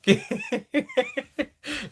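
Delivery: high giggle trailing down; real laughter at a fan comment. Real, not acted.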